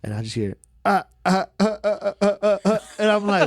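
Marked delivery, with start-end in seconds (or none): 0.8-2.8 high, chopped mock laugh; staccato, high-pitched fake laughing; imitated laugh. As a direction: imitate a bunkie's repetitive cackle in short bursts. Imitates bunkie laughing at comedy.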